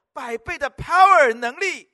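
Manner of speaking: loud climactic shout on "power", slow and drawn; congregation-rousing cry of power; preaching shout. Acted. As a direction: bellow "百倍的power能力" at the peak of the sermon.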